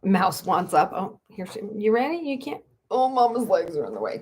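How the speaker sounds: ordinary talk, nothing marked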